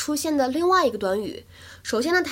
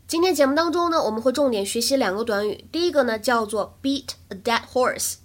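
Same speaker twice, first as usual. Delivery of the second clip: usual speaking voice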